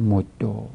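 مدو said softly with a rounded "o" ending; damma ending. Shown as a demonstration. Teacher concluding the damma form, مدو as "moddo". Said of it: "اینم وجه ضم".

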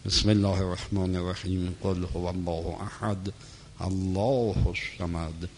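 soft, low monotone recitation of Arabic prayer. Real, not acted. Lecturer leading a Fatiha for the martyrs, hushed prayer recitation. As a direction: recite it quietly and low, on one even note.

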